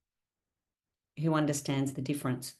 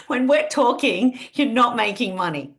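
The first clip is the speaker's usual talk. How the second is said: higher and louder, "talking" punched out; loud punchy version; 5 semitones higher, 6 dB louder than usual. Shown as a demonstration. Coach to her team, first punchy loud take of the motto. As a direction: hit the line hard like a slogan. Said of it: "so many different ways to say this".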